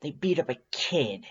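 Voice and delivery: mild, understated spat line; a touch of sudden bite; spitting. Acted. Undyne telling of the attack, a mild spat-out anger. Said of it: "she spits suddenly".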